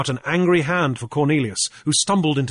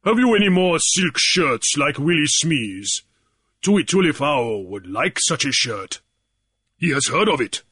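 a big man asking plainly; lightly voiced big chief's guard; 2 semitones higher than usual. Williami asking Grief about silk shirts, lightly voiced.

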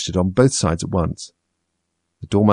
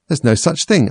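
angry; 7 semitones higher, 4 dB louder than usual. A narrator's reading. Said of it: "very angrily".

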